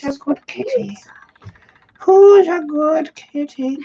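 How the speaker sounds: high, cooing pet voice. Real, not acted. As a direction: coo up high at the kitty like a doting owner. Talking to the cat.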